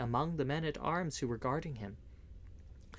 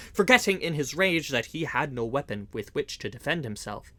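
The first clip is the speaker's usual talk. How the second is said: ordinary delivery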